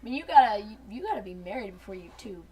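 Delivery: normal talking voice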